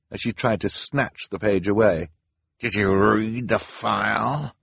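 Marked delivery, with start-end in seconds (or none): 2.6-4.5 slow, menacing demand; terrible voice. A narrator's reading. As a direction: interrogate them slowly in a villain's voice. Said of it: "demanded in a terrible voice".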